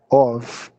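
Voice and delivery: of ending in a V; teacher's demonstration of V in of; of with V sound. Shown as a demonstration. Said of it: "o F tem som de V".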